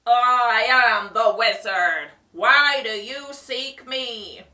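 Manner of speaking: loud, slow booming wizard; echoing voice. A narrator's reading. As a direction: boom it out from nowhere. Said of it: "a voice echoed".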